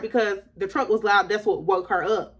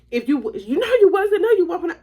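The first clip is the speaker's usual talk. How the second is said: high, talking-over denial; insistent pitched-up scolding; mama yelling; 10 semitones higher, 4 dB louder than usual. Retold or imitated. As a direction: imitate mom cutting her child off. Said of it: "she's down hard yelling at me".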